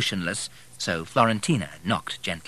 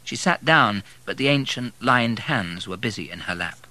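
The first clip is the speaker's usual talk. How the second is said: usual speaking voice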